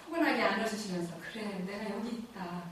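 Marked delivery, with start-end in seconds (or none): 1.3-2.6 soft, hushed voice of comfort; tender reassurance. Retold or imitated. God softly reassuring a lost soul, tender. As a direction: say it like a gentle embrace. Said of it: "이런 느낌인 거죠".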